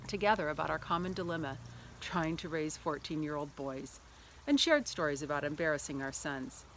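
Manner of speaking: ordinary talk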